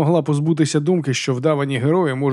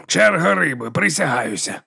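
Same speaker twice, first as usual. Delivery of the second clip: Baltazar's voice; 4 semitones higher than usual. Acted.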